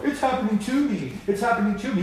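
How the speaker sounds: high, mocking self-pity; whiny victim voice. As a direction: whine it like a victim. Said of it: "And then I go".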